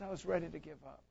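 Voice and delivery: very soft, mildly tearful retelling; hushed, as if retelling someone's weeping admission; retold tearful confession. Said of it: "begins to weep and weep".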